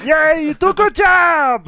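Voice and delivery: high loud slow nasal cheer; nasal cartoon voice. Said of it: "you got to do the nasally".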